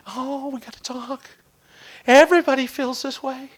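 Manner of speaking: lightly hammed-up moaning complainer; whiner. A whiner airing a grievance to the boss, whiny.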